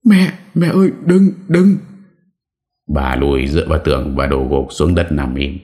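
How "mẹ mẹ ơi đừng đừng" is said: high, loud panicked shriek; desperate shrieking plea; terrified scream. A narrator's reading. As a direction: scream in terror at the ghost. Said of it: "Bà Thủy gọi thất thanh".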